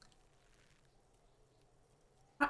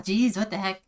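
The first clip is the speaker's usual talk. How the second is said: ordinary talk, nothing marked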